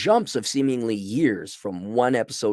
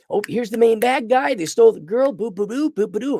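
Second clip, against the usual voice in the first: lightly hammed-up mock excitement, higher; mock the cliché villain plot, booing; mocking play-by-play; 11 semitones higher, 3 dB louder than usual. Reviewer mocking the anime's plot: mock hype.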